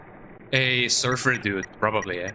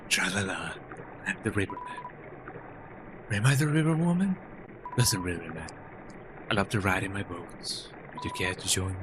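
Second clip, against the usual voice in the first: river person; 4 semitones lower, 7 dB quieter than usual. Acted.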